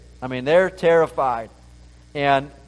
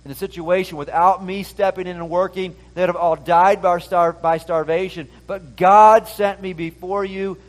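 loud, impassioned sermon reading; fervent preaching; 4 semitones higher, 6 dB louder than usual. Acted. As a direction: proclaim it to the congregation with fire. Preaching with rising force.

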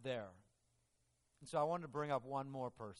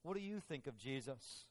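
ordinary delivery

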